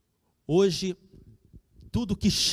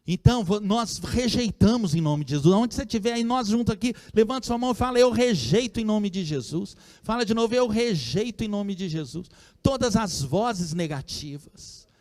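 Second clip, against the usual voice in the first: fervent preaching; 6 semitones higher than usual. Acted. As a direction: lift the crowd to reject evil. Preaching at full force. (pregação fervorosa e alta)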